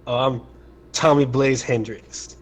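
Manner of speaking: usual speaking voice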